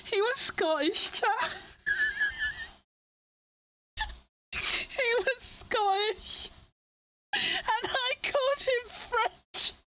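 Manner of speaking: high-pitched, slowing, cracking up mid-sentence; helpless laughing. Real, not acted. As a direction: struggle to get the punchline out through laughter. Cracking up at own punchline.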